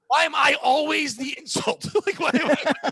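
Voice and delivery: high, slow, whining protest through a laugh; mock whiny kid. Kid named Rude protesting to class, high whine. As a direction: play the bewildered kindergartner.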